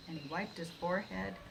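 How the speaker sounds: ordinary delivery, nothing marked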